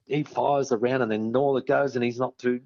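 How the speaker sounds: ordinary delivery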